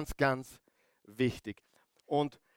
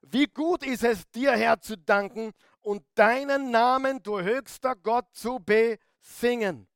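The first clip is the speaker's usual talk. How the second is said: full-force preaching; 8 semitones higher, 5 dB louder than usual. Acted. Psalm proclaimed at full force.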